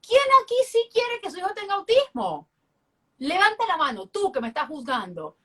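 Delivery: high-pitched challenge to a room; retold challenge. Mother confronts crying parents who are judging her, challenging.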